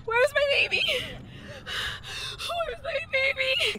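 put-on high, drawn-out wail for a lost child; distant, tearful screaming; hysterical crying. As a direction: wail in mounting panic. Mother screams for vanished baby.

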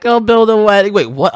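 usual speaking voice

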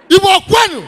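loud high rallying call; rally cry shout. Acted. Comedian calling out to Igbo in crowd, shouting. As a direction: call the crowd like a chant leader.

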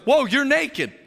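loud, high startled whoa; Adam suddenly seeing Eve is naked; mock shock. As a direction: blurt it out in surprise. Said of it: "he looks at her and goes".

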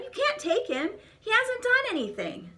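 high, urgent protest; pleading cry. A narrator's reading. Boy protests to soldiers, high pleading cry. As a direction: beg the soldiers to let him go. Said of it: "he cried".

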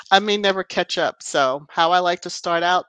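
ordinary talk

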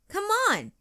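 very high called come on; yelled. A narrator's reading. Jojo calling Katie to ride, high yell. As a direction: call across to a friend. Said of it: "yelled Jojo".

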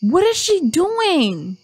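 loud, high, drawn-out disbelief; exasperated yell. Real, not acted. Player appalled at Abigail out in the dark, exasperated shouting. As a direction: shout it high, stretching "doing".

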